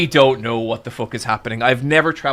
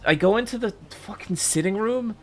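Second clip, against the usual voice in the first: usual speaking voice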